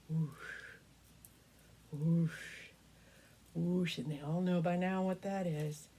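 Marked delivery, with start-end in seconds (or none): 0.1-4.0 whooshing wings. Retold or imitated. Storyteller making wing sounds for the children. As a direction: breathe out the rush of dragon wings. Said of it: "they hear".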